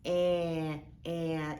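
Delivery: "eh" held out as the short a of bandit; drawn-out short vowel "eh, eh"; isolated short vowel. Shown as a demonstration. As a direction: say "eh" slowly, stretched and repeated. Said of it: "And I hear".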